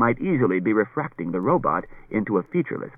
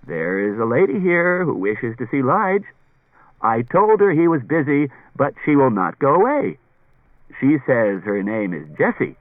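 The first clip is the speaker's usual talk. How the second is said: lightly voiced robot messenger, pitched up; R. Sammy; 5 semitones higher, 4 dB louder than usual. A narrator's reading. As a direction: announce a visitor in a lightly put-on robot voice. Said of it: "R. Sammy's slurring voice said".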